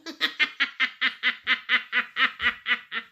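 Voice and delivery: rapid staccato laugh; long chuckling laugh. Real, not acted. Painter amused by the SpongeBob theme, long laugh.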